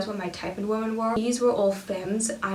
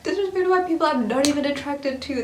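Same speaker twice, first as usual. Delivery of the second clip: laughing through it, a little higher and louder; laughing disbelief; 6 semitones higher, 4 dB louder than usual. Real, not acted. Laughing in disbelief at being disrespected by someone she wasn't into. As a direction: laugh out the outrage.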